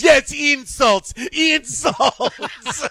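high, drawn-out mock outburst; hammed-up imitation of an indignant Russian; Russian villain. Host acting out guy from the Russian Federation, mock outburst. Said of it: "and then he goes".

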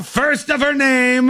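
Game of Thrones herald; mock royal proclamation. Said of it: "sounds like something out of Game of Thrones".

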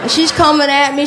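ordinary talk, nothing marked

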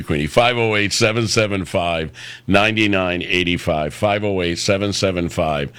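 ordinary talk, nothing marked

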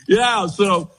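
ordinary talk

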